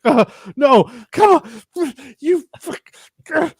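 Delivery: high, loud, acted-out desperation; panicked shouting. Speaker acts out Joel shooting and missing, panicked shouting. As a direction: shout in helpless frustration, imitating the character. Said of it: "The panic and, and just, just the sadness".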